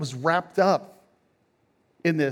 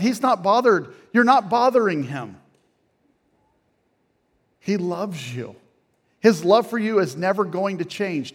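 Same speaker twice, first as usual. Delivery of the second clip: rising, louder preacher's build, higher and louder; impassioned preaching; 5 semitones higher, 4 dB louder than usual. Acted. Pastor urging listeners to bring pain to God, impassioned preaching. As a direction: press it home to the congregation, climbing in pitch.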